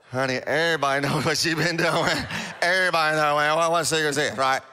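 dismissive neighbour voice, lightly put on; gossiping townsfolk. Market gossips scoffing at the outcast woman, mildly mocking. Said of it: "people in the marketplace going".

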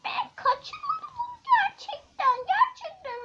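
villain shrilly denying the kidnapping; whiny denial. Acted. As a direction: protest your innocence, whining. Accused villain protests.

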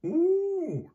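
very high, loud "ooh"; long high hoot of a cow; buffalo cow noise. A narrator's reading. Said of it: "sagte die Kuh".

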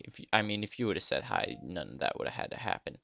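usual speaking voice

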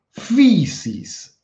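fisis con la I francesa y tono alto; Greek physis. Shown as a demonstration. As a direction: say Fisis high, with the French-style vowel. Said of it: "quiero que en su casa traten de pronunciar". (fisis con la i del francés y tono alto)

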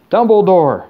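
high, loud, desperate gasp of a name; gasped cry. A narrator's reading. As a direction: gasp it out urgently. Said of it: "gasped Mister Crouch".

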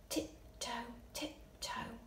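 whisper. Shown as a demonstration. Teacher creeping into the story cave, whispering. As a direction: sneak the words out on tiptoe.

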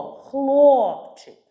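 «Хлопчик» called high and loud, long stressed о; calling out. Shown as a demonstration. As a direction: call the boy, KHLOOOP-chyk in «Хлопчик». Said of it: "А хлопчика спробуйте покликати". (гучно кличе, протяжне «о» в слові «Хлопчик»)

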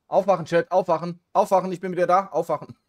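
high, loud wake-up call. Real, not acted. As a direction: shout to rouse the room. Calling chat to wake up.

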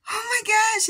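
very high-pitched, amused gasp of words; amused shock. Retold or imitated. Reacting to the little girl's advice, high amused shock. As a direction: react in mock shock, voice shooting up.

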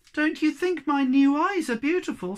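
high, loud, upset plea; wounded, high-pitched question; close to tears. Acted. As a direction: plead as if about to cry. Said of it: "she started to cry".

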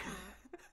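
high airy laugh. Real, not acted. Embarrassed by the text.

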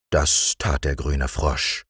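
usual speaking voice

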